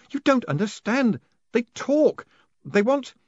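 frantic. A narrator's reading. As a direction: cry it out, desperate. Moist frantic with the golem.

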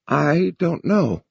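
slow, trembling and frightened; quavering. A narrator's reading. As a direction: let the voice quaver with fear. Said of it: "she quavered".

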